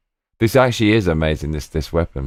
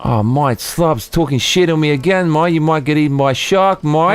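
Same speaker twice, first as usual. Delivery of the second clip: high-pitched, exaggerated Australian accent; playfully aggrieved Australian mate; mock Aussie grumbling; 7 semitones higher than usual. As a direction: complain as a cartoon Aussie, pitched right up. Aussie act complaining about chatter.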